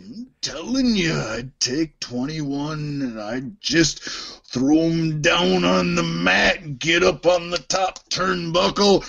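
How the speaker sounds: stretched-out wrestler impression; slow, menacing promo build-up; Macho Man Randy Savage. Host threatens the henchman, as Macho Man. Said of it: "That would be easier than Macho".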